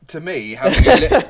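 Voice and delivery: bursting into laughter mid-sentence; giggling through the words; laughing. Real, not acted. Teasing her co-host about his morals, laughing.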